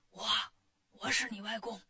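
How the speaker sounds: soft hoarse reply. A narrator's reading. Wukong answers the demon king after shouting all night, hoarse. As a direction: struggle to get the voice out. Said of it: "你嗓子怎么了".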